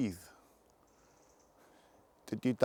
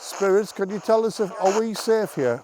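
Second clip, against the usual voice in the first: higher, projected appeal; calling out; 5 semitones higher than usual. Real, not acted. Ghost hunter asks spirits whether the team is safe, calling out. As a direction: call to someone unseen.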